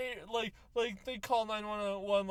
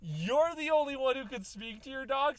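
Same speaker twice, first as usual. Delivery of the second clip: ordinary delivery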